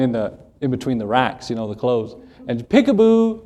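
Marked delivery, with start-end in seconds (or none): 2.7-3.4 put-on high sing-out, like a hiding child; pitched-up playful call; playful peekaboo. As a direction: pop out with a mock peekaboo. Imitates hiding child popping out.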